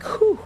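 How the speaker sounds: high whew of relief; relieved whew. Retold or imitated. Telling chat about eye test, a high relieved whew. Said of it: "So I was like".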